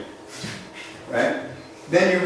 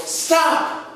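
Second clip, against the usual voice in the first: mock shout; 13 semitones higher than usual. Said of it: "jump off the horse and go".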